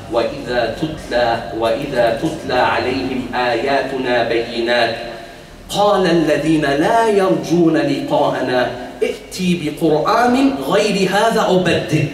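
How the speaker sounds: measured, melodic Arabic recitation; Quran recitation. Real, not acted. Preacher quoting the Quraysh's answer from scripture, reciting. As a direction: recite the verse in a sustained chant.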